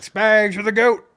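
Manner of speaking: loud hype shout; calling out. Retold or imitated. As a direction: call it across the field. Calling out to coach.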